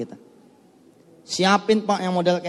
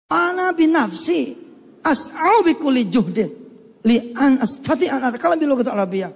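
proud recitation; 7 semitones higher than usual. Retold or imitated. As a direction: declaim it proudly. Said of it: "retells his own Arabic vow".

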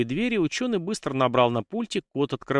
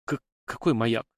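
stuttered, evasive question; nervous stammer from a cornered scientist. A narrator's reading. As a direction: catch on the first consonant. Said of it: "заикаясь, переспросил учёный".

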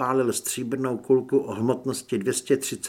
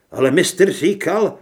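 higher, loud protest; raised voice of a follower; objecting cultist; 5 semitones higher, 9 dB louder than usual. A narrator's reading. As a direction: protest to the leader.

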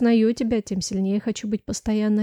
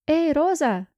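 высокий окрик; calling out; 6 semitones higher, 3 dB louder, slower than usual. Acted. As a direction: call her across the corridor. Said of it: "она окликнула". (громко окликает)

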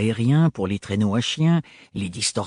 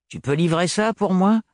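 put-on higher, offhand young mechanic; brisk Gaëlle voice; 7 semitones higher than usual. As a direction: toss out the request casually.

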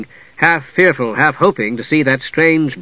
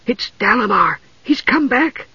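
fearful whisper; 9 semitones higher than usual. A narrator's reading. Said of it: "she whispered fearfully".

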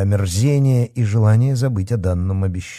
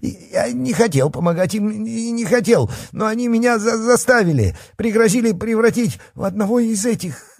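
high, stammering whine; panicked excuses; 11 semitones higher than usual. A narrator's reading. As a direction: plead your case in a squeaky, faltering voice. Terrified coward pleads his innocence.

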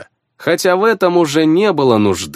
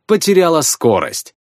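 ordinary delivery

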